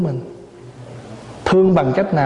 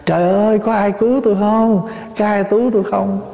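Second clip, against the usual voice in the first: mock cry for help; 5 semitones higher than usual. Locked-in Zen master calls to his disciples, mock cry for help. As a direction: imitate a plea for rescue, pitched up. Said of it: "ông la lên".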